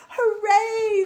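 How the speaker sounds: high, loud joyful cheer; excited cheer. Acted. Eager girl cheers on her first school day. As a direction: burst out with glee.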